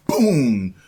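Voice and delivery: loud high boom, dropping in pitch; explosive falling boom; booming impact. Retold or imitated. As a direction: mimic a sudden blow with the word. Another reflection hits, retold.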